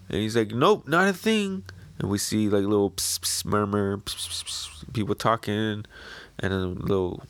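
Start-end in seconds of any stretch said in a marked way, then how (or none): 0.5-1.6 villager playing dumb. 4.0-4.9 soft unvoiced pss pss whisper sounds; whispered psst. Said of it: "they're all whispering with each other".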